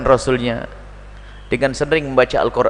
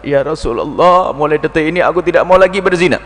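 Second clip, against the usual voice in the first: lightly voiced weeping repentance; trembling in tears. Repentant man vowing to the Prophet, mildly tearful. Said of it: "gemetar lalu menitikkan air mata".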